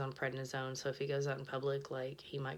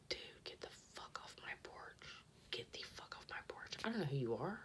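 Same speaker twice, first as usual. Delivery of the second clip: fierce whisper, much softer; whispered order; 9 dB quieter than usual. Retold or imitated. Whispering at the stranger on her porch to leave. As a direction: hiss it so he can't hear.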